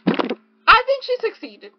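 high, loud, slow awe; gushing praise. Real, not acted. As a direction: melt over the author's success. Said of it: "I think she succeeded in that mission".

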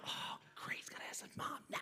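mock groaning whisper; quick exasperated whisper; whispered aside. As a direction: hush it behind the friend's back. Aside while friend asks mom.

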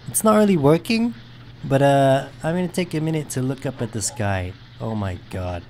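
normal talking voice

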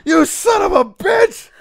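high, slow wailing yell; hammed-up tearful scream; imitated crying shout. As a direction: wail it out like a pranked victim. Acts out terrified victim's reaction.

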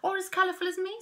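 high, loud jealous squeak; vain. Acted. Parrotfish boasting, high and loud. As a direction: show off. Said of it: "said a parrotfish".

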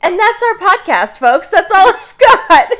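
high, loud, triumphant wrap-up; mock sign-off. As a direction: end the show like a hammed-up announcer. Mock sign-off after headline.

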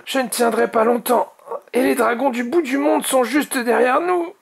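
high-pitched, strained alarm; urgent warning. A narrator's reading. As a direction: warn your team you can't hold on. Struggling dragon warns the team.

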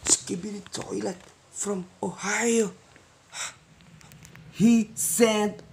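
ordinary delivery

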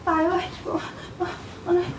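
put-on softly hushing a scared little child; soft soothing. Mẹ dỗ dành đứa con tưởng tượng. (dỗ dành nhẹ nhàng, khe khẽ)

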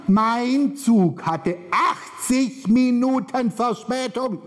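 high, slowly stretched announcement; drawn-out outrage. Retold or imitated. Telling the audience her train's delay, high and drawn out. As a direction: hold every word high and long.

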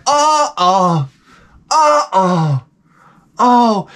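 high mock-agonized moans; pained groans. Hungover passenger as the music gets cranked up, pained groaning.

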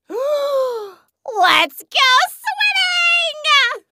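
high, drawn-out excited squeal; gasp, then stretch it out high and giddy; gleeful cheer. Acted. Gleeful call to swim.

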